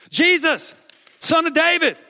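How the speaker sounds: full, high-pitched shout. Acted. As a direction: holler over those hushing you. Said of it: "he called out even louder".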